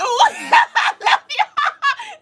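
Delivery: very high, very slow, laughing shout of love; laughing squeal. Real, not acted. Repeats thanks, now squealing.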